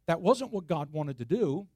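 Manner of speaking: ordinary talk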